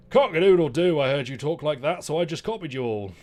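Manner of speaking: high, loud crowing rooster. Acted. Rooster asks the others about rhyming, loud high crow. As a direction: crow the question like a cheeky rooster.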